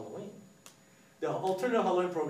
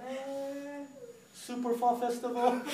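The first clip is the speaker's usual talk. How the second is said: unconvinced; 9 semitones higher, much slower than usual. Acted. Kids unimpressed by name ideas.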